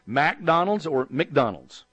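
slow, drawn-out McDonald's said two ways; Texas drawl option. Shown as a demonstration. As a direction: offer both takes of McDonald's, the second drawled. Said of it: "Which way do you want me to go?".

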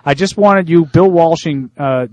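ordinary delivery

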